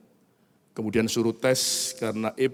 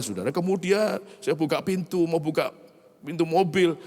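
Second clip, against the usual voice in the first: ordinary delivery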